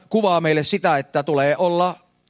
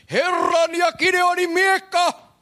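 long drawn-out high war cry; battle cry; 14 semitones higher, slower than usual. Acted. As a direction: cry it out like an army blowing trumpets. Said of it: "ja puhallettiin".

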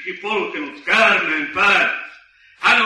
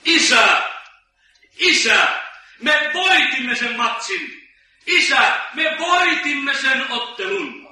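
raised, triumphant calling out; excited shouting; 4 semitones higher, slower than usual. Acted. Boy calls to his father from the stairway, excited shouting. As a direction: call out the victory, stretching the words. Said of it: "hän huutaa täysin voimin".

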